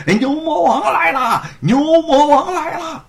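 high, loud cry of alarm; loud shout. A narrator's reading. Storyteller tricking the constables while fleeing: loud shout. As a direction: yell a fake warning. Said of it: "大喊".

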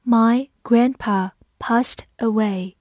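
each word drawn out slowly; slow, careful model reading. Shown as a demonstration. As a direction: read it slowly for learners. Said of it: "我们把这两句话慢读一遍".

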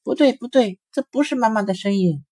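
Long Ears stops the others, high and alarmed; wary little rabbit. A narrator's reading. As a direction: warn the others urgently. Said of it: "拉住红眼睛和短尾巴说".

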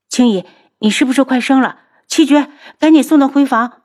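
loud, high, rushed orders; high-pitched urgent alarm; alarmed urgency. Acted. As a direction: panic as the birth begins. Alarmed as labour starts.

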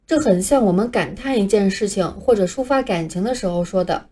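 ordinary delivery

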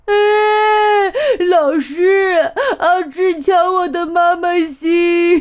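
high, loud tearful wail, slowing down; crying child. Acted. A child crying to the teacher that 阿志 took the heart, high loud sobbing wail. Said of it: "每天都有小朋友哭着说".

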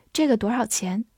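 ordinary delivery

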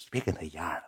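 low, whispered plea in retelling; hushed placating. Retold or imitated. Peacemaker calms the offended man, hushed placating. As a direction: soothe him almost under your breath.

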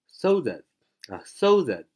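"SO that" with "that" light and short; punched SO, unstressed schwa in "that"; weak-form that. Shown as a demonstration. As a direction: stress SO, reduce "that" to "thuht". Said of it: "这就是在这个句子当中的用法".

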